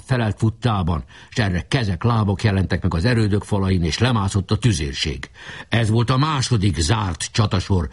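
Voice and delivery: ordinary delivery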